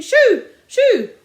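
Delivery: high, loud shoo calls; shouting at birds. A narrator's reading. Sergeant shouting to chase pheasants away, loud. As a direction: wave them off.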